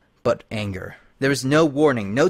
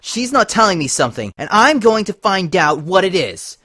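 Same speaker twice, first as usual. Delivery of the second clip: high, loud furious outburst; paranoid shout; 8 semitones higher, 7 dB louder than usual. Acted. Twilight shouting at Lyra, high paranoid fury. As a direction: yell it in suspicious rage.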